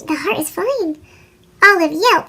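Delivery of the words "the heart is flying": ordinary delivery, nothing marked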